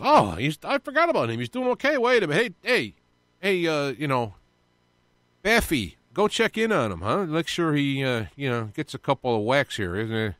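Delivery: high scheming devil voice. Retold or imitated. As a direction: play a gleeful, plotting devil. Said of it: "The devil goes".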